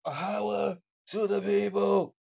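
shouted slow rally chant; slogan shout. Acted. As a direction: call it out like a protest chant.

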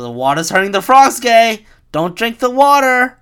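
loud, high-pitched alarmist shouting; mock conspiracy rant. Con artist warning people about water, loud mock-alarmist rant. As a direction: shout a hammed-up warning to the public.

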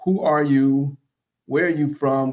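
normal talking voice

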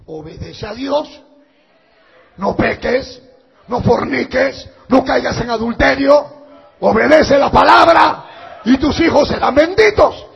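loud, high, driving sermon shout; preaching at full force. Acted. Preacher warns the congregation against sin, full-force preaching shout. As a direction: thunder the warning at the congregation.

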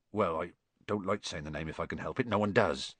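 low, soft, reluctant giant; shy away from the subject uneasily; Hagrid. A narrator's reading.

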